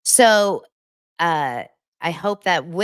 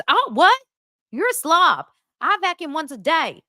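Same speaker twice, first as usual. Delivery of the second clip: high, loud, scandalized retort; hammed-up appalled judgement; mock outrage; 10 semitones higher, 5 dB louder than usual. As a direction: scold them in a pitched-up imitation. Acts her outraged reply to him.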